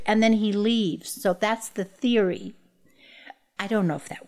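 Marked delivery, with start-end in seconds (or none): none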